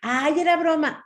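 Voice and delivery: high, loud mock protest; dismissive protest. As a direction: brush it off in an exaggerated high voice. Friend brushing off the hurt.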